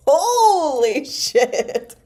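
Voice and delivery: high, slow laughing curse; real laugh breaking into the curse; laughing disbelief. Real, not acted. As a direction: laugh it out, floored by the number. Reader reacts to price.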